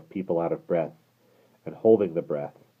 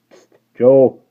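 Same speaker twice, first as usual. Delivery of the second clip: loud, higher urgent hiss; warning voice; 7 semitones higher, 17 dB louder than usual. Acted. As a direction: warn him sharply. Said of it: "cried the coachman in a warning voice".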